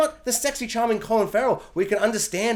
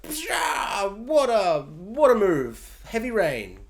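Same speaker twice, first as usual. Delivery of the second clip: smug Thunderbird; much slower than usual. Retold or imitated. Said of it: "Big Bird is like".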